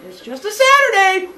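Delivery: high, loud singsong squeal; breezy falsetto mock cheer; carefree neighbours. Eighties neighbours shrugging off a dragged child, high loud breezy call.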